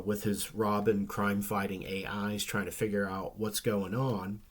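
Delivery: normal talking voice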